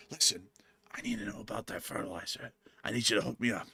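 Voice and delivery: breathless secret whisper. Retold or imitated. Host voicing farmer whispering to a shady dealer. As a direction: lean in and whisper the request.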